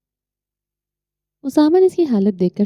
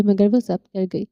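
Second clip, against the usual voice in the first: usual speaking voice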